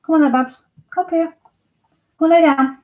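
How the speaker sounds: high-pitched pet-calling voice; very high, encouraging dog talk; coaxing a dog. Real, not acted. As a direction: coax the dog over in a high, lifted voice. Coaxing the dogs over.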